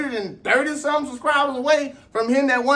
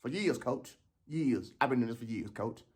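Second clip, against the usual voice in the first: much lower and much softer, a beaten whine; deflated complaint; 11 semitones lower, 14 dB quieter than usual. Acted. Beaten sprinter whining low and hushed to his coach. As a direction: moan it to the coach under your breath.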